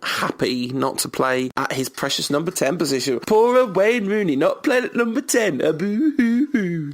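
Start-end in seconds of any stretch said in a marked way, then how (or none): 3.2-6.9 high, whiny mock sympathy; mock pity. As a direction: fake boo-hoo crying for him.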